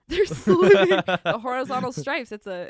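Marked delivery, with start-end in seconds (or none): 0.1-1.0 high, loud, slow laughing repeat; real laughter, pitched way up and drawn out; laughing disbelief. Real, not acted. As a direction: burst out laughing through the words. Repeating the absurd excuse.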